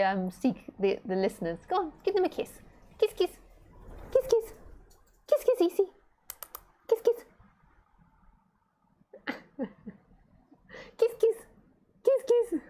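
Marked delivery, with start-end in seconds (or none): 1.7-9.7 put-on very high cooing baby talk; coaxing pet talk. Coaxing the pet bird to kiss. 11.0-12.6 put-on very high cooing baby talk; coaxing pet talk. Coaxing the pet bird to kiss.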